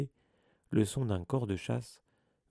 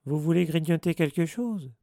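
higher, louder, mock-courteous poltergeist; amiable offer; 6 semitones higher, 8 dB louder than usual. A narrator's reading. Peeves offers rotten peanuts to the children, amiably. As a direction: offer it brightly and loudly. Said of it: "proposa-t-il aimablement".

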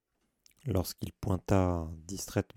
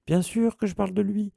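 loud, much higher heated exclamation; exclaiming; 12 semitones higher, 9 dB louder than usual. Acted. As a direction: burst out in indignation. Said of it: "s'exclama Ron".

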